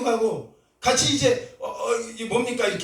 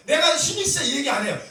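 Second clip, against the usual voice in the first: ordinary talk, nothing marked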